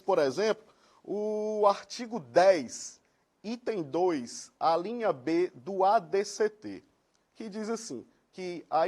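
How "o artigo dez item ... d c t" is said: slow, deliberate citation; slow dictation. Real, not acted. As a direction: spell out the reference slowly. Citing legal article slowly.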